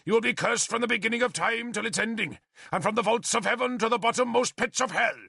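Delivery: damn them from the pulpit; heavy curse. A narrator's reading. Bishop delivers a heavy curse.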